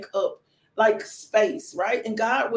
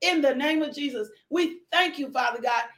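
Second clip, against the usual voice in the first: fervent prayer; 4 semitones higher than usual. Acted. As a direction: pray it out with full force, rising. Leads an opening prayer.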